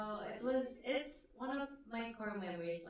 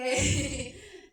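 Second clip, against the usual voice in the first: short laugh; 4 semitones higher, 10 dB louder than usual. Real, not acted. Laughs mid-answer.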